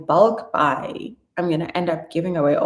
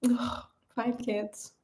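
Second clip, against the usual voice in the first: awed whisper; 4 semitones higher, 10 dB quieter, much slower than usual. Real, not acted. Awed whisper at five kids.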